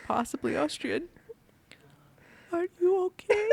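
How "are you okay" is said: put-on gentle German accent. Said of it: "in this very gentle German accent".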